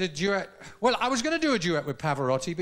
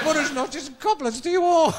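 put-on lightly Spanish-accented greeting; 7 semitones higher, 3 dB louder than usual. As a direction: say it like a Spanish singer.

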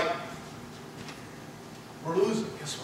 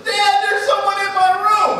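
put-on high, louder child's voice; imitated little daughter; 8 dB louder than usual. Four-year-old back at dad's bed, imitated urgent child voice. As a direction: mimic a small girl urgently alerting her father. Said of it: "She comes in".

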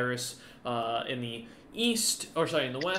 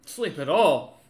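louder, incredulous scoff at the idea of sleep; wry scoff; 2 semitones higher, 9 dB louder, slower than usual. Acted. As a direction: scoff it off, half laughing. Exhausted officer scoffs at comment.

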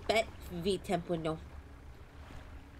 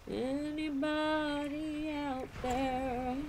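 slow, higher far-off call; 6 semitones higher, 4 dB quieter, much slower than usual. Pretend. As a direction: call into the empty night. Calling out into the dark.

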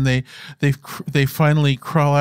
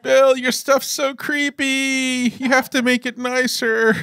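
high, slow pleading voice; teasing friend begging the author to lighten up; retold plea; 11 semitones higher, slower than usual. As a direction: whine it up high like a nagging friend. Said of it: "And I'd say".

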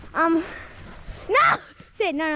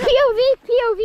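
loud, higher call; 6 semitones higher, 6 dB louder, much faster than usual. Real, not acted. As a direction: shout it across the field. Calling out instructions.

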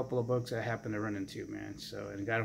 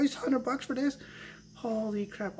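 retold surprise, voice jumps high; disbelief; 11 semitones higher than usual. Collector retelling the hundred-dollar deal, amazed. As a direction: replay the shock of the bargain, high and amazed.

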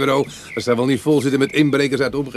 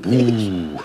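mock spooky oeh. Spookt Sandy plagerig.

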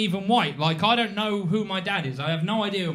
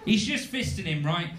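ordinary delivery

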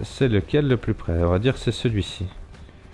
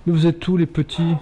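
calling out; 7 semitones higher than usual. Real, not acted. Calling out for his pets.

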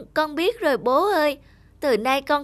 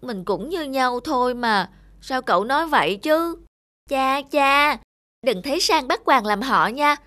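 ordinary talk

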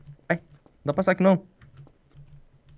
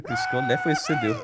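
excited; 7 dB quieter than usual. Real, not acted. Amazed at friend's escape.

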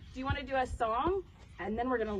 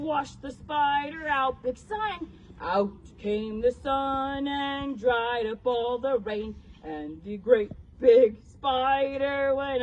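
loud, booming spider voice; great big voice; 6 dB louder than usual. Shown as a demonstration. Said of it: "we have to have great big voices".

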